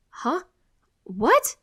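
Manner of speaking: sudden high, loud "What?"; confused yelp. Acted. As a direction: blurt it in confusion. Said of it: "I yelp, confused".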